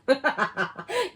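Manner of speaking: loud phrase breaking into high laughter; real amused laughter. Real, not acted. Reader amused by a phrase not heard in a long time, laughing. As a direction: crack up on the catchphrase. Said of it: "That's so funny".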